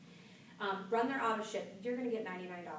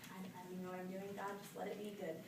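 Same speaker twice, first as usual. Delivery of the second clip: nervous muttered prayer; 8 dB quieter, faster than usual. Retold or imitated. Nervous prayer before entering salon.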